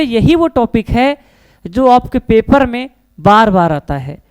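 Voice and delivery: slowed down, each word weighed, वो still lifted; slow emphasis. Real, not acted. Teacher repeating the warning to students, slow and deliberate. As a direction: spell it out slowly so it sinks in.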